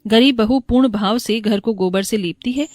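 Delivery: ordinary delivery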